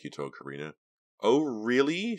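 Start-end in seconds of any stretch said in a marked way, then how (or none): 1.2-2.2 sarcastic. Acted. Rolls her eyes.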